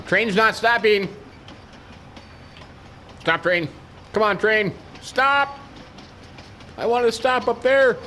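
high, urgent pleading; mock panic. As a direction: beg the runaway train to stop. Train will not stop.

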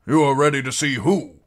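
loud, high challenge; indignant knight. A narrator's reading. Knight questioning his commander's order, indignant.

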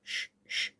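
soft, voiceless "shh, shh"; hushing shush. Acted. Hushing a noisy companion while hiding. (గుసగుసగా "ష్, ష్" అని వారించడం)

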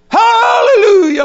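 high, drawn-out shouted hallelujah; sudden piercing shout of praise; imitated shout. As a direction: belt it out like a startled worshipper. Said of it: "like that".

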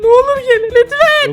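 very high, loud begging; desperate screamed plea. Real, not acted. Player begging friends to join him in a horror game, desperate. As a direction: shriek it, begging them to join you.

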